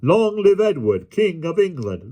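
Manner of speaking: high, rousing call; leading a cheer. A narrator's reading. Ruffler leads the crew in saluting the king, rousing high call.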